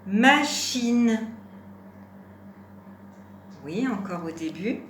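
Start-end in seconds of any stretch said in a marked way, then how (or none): 0.1-1.2 loud, deliberate teacher's model word; syllables held evenly and loudly; word dictation. Shown as a demonstration. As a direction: project each syllable clearly for a learner.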